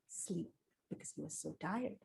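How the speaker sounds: soft, sleepy hush; whisper. Acted. Cocorico falling asleep, whispered. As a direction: let it drift off into sleep.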